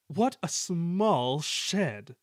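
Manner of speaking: haughty engine grumbling. A narrator's reading. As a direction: drag each word out in disgust. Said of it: "grumbled Sir Handel".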